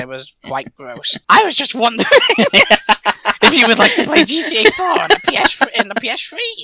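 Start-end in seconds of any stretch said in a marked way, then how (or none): 1.3-6.6 high-pitched, excitable landlord invitation; over-eager comic pitch; eager character voice. Acted. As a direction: put on a high voice, breaking into laughter.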